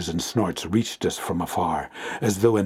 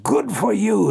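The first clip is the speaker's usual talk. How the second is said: high, louder delighted cry; excited cry; 11 semitones higher, 3 dB louder than usual. A narrator's reading. Foreman cheering the narrator, high delighted cry. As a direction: cheer him on, pitch jumping up. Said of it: "he cried".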